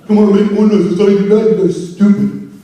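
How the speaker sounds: egging on the crowd; mock pushy hype man. Bad MC demanding noise, mild mock hype. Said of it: "I would never tell people to make more noise".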